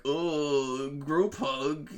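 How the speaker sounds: drawn-out comic groan; mock reluctance. Comic book friends moaning at a group hug, mock reluctance. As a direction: groan through it in mock dismay. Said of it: "for fun and comedy, they're like".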